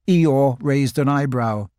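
ordinary delivery

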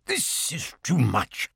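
soft, slow indignation; exasperated. A narrator's reading. Owl fed up with the signs, exasperated. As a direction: say it slowly under the breath.

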